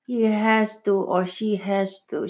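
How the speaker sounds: ordinary delivery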